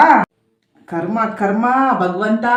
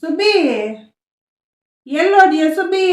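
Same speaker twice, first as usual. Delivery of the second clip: calling out; 4 semitones higher, 3 dB louder than usual. Acted. Mother-in-law calling deaf daughter-in-law.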